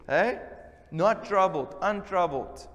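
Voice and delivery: normal talking voice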